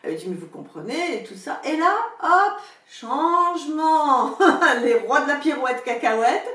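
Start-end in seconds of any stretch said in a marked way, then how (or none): 1.6-4.4 high, loud, drawn-out exclamation; dramatic announcement. Real, not acted. Telling listeners the old man suddenly flips, dramatic. 4.4-6.5 loud line spoken through laughter; laughing. Real, not acted. Mocking the old man's sudden turnaround, laughing loudly.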